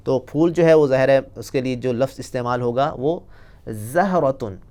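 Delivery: normal talking voice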